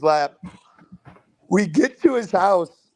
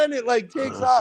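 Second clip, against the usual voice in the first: ordinary talk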